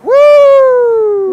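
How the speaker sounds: loud, very high whoop; excited whoop. Real, not acted. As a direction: let out a big cheer. Celebrating the catch.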